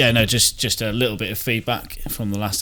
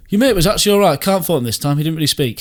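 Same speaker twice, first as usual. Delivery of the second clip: much higher, louder mock voice; imitating Sean; 8 semitones higher, 7 dB louder than usual. Quoting listener Sean's backhanded praise, high mock voice. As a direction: mimic the grudging critic. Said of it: "he went".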